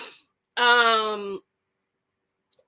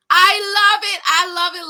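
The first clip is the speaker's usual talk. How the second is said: excited gushing; 7 semitones higher, 9 dB louder than usual. Real, not acted. Gushing over contestant Nate.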